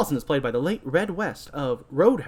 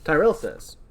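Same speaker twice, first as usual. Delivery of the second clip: ordinary delivery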